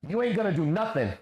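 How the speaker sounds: high-pitched quoted taunt; mocking read-out. Streamer repeating a challenger's taunt before answering, mockingly.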